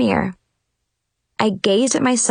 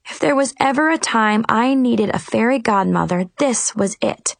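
plain reading voice

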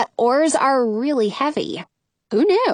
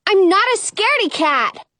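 snapping; 7 semitones higher than usual. Acted. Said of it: "I snap".

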